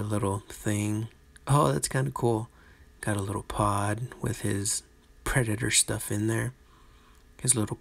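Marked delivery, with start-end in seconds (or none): none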